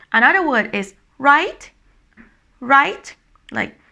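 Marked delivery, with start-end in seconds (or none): 1.2-3.2 "right" rising at the end; high questioning tone. Shown as a demonstration. Teacher giving another English example, rising "right". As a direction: lift "right" as a tag question. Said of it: "is the sound of high tone".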